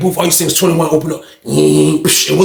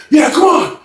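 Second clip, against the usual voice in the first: higher eager call; fired-up shout; 7 semitones higher than usual. Retold or imitated. John calls the predator back in, fired-up shout. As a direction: shout him on, ready. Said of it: "John was like".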